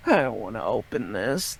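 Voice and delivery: soft, reluctant dread; retold reluctance. Man replaying his dread at the punched front door, soft reluctance. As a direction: say it small and unwilling.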